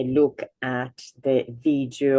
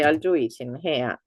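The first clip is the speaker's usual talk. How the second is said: ordinary delivery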